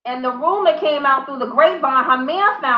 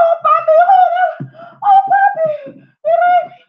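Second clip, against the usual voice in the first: very high, whiny fake crying; squeaky mock-distraught voice; mock wailing plea; 14 semitones higher, 4 dB louder than usual. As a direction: wail it like a hammed-up pleading girlfriend. Acted girlfriend wailing to her man again.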